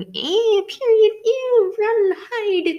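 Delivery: high, loud, slow mock squeal of disgust; teasing imitation of a squeamish girl. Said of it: "You being".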